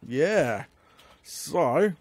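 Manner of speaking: ordinary delivery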